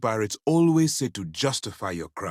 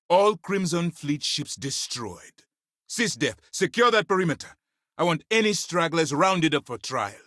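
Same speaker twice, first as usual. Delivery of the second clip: high, raised victory proclamation; military command, pitched up to carry; commanding announcement; 8 semitones higher than usual. Acted. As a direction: call out orders to the whole force. Commander announces victory, issues orders to force.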